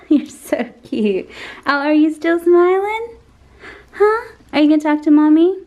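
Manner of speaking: high, slow coo with laughs. Real, not acted. Mom cooing at baby.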